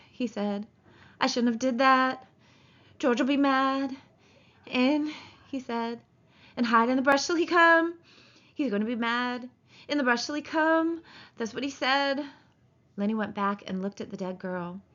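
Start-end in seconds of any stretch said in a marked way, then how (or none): 1.2-12.2 put-on high, childlike fretting; frightened simple man. Man recalling where to hide, childlike and anxious. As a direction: recite the instructions anxiously to yourself. Narrator voicing frightened Lennie.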